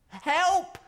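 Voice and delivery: high, loud cry for help; a frightened penguin shouting out; calling for help. A narrator's reading. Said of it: "called Penguin".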